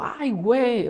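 high, sudden alarmed gasp of words; a startled ay güey; startled exclamation. Retold or imitated. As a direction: react like you just heard shots.

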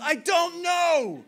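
high, slow, drawn-out yell; alarmed shouting. Retold or imitated. Finnish teammate replying across the forest, alarmed drawn-out shout. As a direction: holler it back at full volume.

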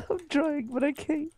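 high-pitched strain. Real, not acted. Struggling in fight, giggling.

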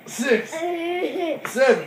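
slow, strained count at the top of a rep; a pained, stretched-out count; straining count. Real, not acted. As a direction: drag the numbers out through the burn. Straining through the workout reps.

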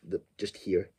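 ordinary talk

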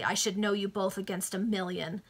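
ordinary delivery, nothing marked

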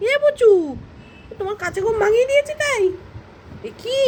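very high, sing-song coaxing. Real, not acted. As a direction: tease the sulking pet in a high voice. Coaxing a sulking pet.

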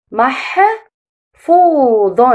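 محفوظ stretched very slowly; slow modelled word. Shown as a demonstration. Teacher models the word slowly for pupils to repeat. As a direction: drag out محفوظ for children to copy. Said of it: "رددوا".

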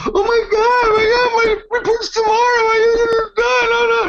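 high, loud fake-crying shout; mock panicked wail. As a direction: wail like a distressed actress in hysterics. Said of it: "she's a good actress".

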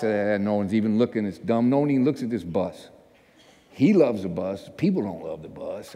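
low, sullen griping to himself; grumbled complaining. Retold or imitated. Reluctant staffer washing the bus alone, grumbled complaining. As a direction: imitate a sulky complaint under your breath. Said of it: "I'm complaining".

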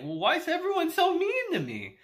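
put-on a sulky child asking why everyone's mean; hurt whine. Ainz sulking to himself, in a high hurt whine. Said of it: "in his head, he's like".

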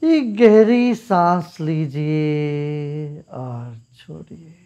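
calm, unhurried meditation guide with long pauses; guided meditation. Real, not acted. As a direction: lead them gently into stillness, phrase by phrase. (शांत, ठहराव भरा ध्यान निर्देश)

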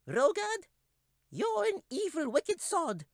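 very high squeaky shout of defiance; tiny creature shaking a fist; squeaky yell. A narrator's reading. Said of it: "yelled out in his squeaky little voice".

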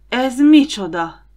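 a startled, drawn-out cry of disbelief; stunned gasp. A narrator's reading. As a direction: choke out the question in alarm. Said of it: "nyögte ki Don".

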